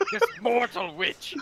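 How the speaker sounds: ordinary talk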